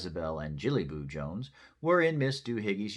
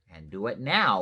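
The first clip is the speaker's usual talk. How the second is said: firm, louder command; motherly order; 7 dB louder than usual. A narrator's reading. Mama orders the bones returned, firm order. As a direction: order a child to act. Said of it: "ordered Mama".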